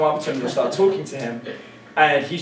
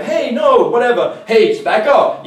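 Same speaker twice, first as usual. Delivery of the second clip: higher, louder nervous retreat; higher, slow, jittery back up; mock fearful backing off; 8 semitones higher, 8 dB louder, slower than usual. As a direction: back off, scared and pleading. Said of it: "most people get fearful and go".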